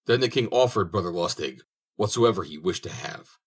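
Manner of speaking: plain reading voice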